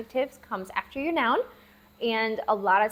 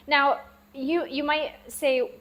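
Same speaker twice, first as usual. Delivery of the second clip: normal talking voice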